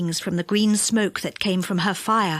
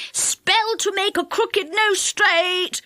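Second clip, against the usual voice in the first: pixie crying wares; 9 semitones higher, slower than usual. Acted. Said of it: "cried one pixie".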